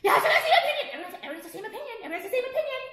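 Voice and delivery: high, giggling sarcastic taunt; laughing mockery. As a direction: laugh at them while you say it. Mocking everyone sharing one opinion.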